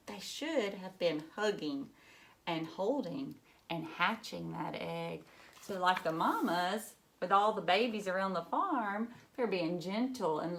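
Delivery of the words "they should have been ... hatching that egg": put-on lively read-aloud for children; storybook reading. A narrator's reading. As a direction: read it like a picture book to a class. Reads picture book aloud to children.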